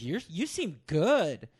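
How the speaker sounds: a hint of a disinterested counselor; mock therapist. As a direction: drag it out like a bored therapist. Mocking the therapist's reply.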